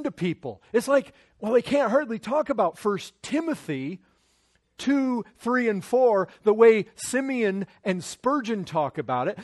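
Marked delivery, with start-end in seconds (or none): none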